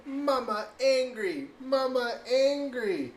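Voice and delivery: put-on sing-song it like a two-year-old; toddler voice. Mom imitating her son repeating a warning, slow high toddler voice. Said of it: "he just goes, like".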